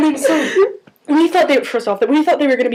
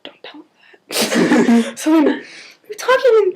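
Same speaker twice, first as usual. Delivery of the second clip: ordinary talk, nothing marked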